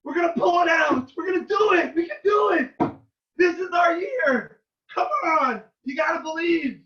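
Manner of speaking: high, loud shouting of faith; rally the room, refuse to doubt; defiant fan cry. Retold or imitated. Young fan to friend's father, shouting belief in his team. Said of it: "And I said".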